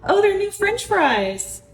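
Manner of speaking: mock sweet parent. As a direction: sell it to the kids sweetly. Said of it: "and just be like".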